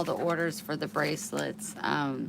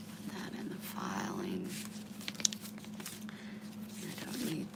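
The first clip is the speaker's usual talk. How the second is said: whispering aside; 9 semitones lower, 11 dB quieter than usual. Real, not acted. Sorting mail aside.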